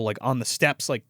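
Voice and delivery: normal talking voice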